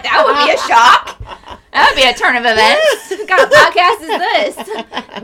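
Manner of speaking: loud, high-pitched amused disbelief; laughing. Real, not acted. Host teases co-host over being the ex, laughing.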